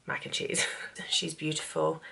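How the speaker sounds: fluisterend tegen het bord eten; a doting whisper to the mac and cheese. Real, not acted. Whispering lovingly to her food.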